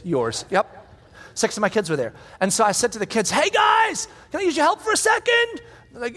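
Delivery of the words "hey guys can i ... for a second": calling out. Retold or imitated. Dad calling kids over.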